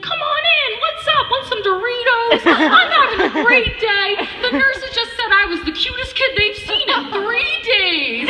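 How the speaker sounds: put-on high, loud, giddy kid voice, laughing; high excited little brother voice. Imitating her little brother in hospital, high giddy kid voice. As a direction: play the doped-up cheerful kid. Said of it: "He's like".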